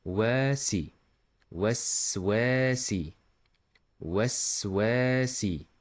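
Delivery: slow "waswasi" with a long second waa; stretched A. Shown as a demonstration. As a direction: draw out the middle "wa" in "waswasi". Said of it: "then stretching".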